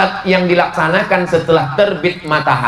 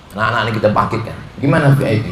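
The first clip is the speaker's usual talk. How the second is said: ordinary talk, nothing marked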